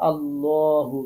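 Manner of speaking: আল্লাহু with a full heavy rounded ল; heavy lam. Shown as a demonstration. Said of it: "ল পড়তে হবে, পোর করতে হবে". (মোটা করে আল্লাহু)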